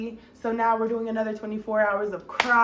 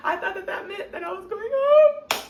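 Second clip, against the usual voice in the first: high, louder, laughing through it; 11 semitones higher, 6 dB louder than usual. Real, not acted. Telling viewers she misread the lawyer, laughing. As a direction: crack up at your own naivety.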